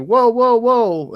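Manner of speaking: high, loud string of whoas; retold startled objection; alarmed protest. Retelling his reaction to the cop.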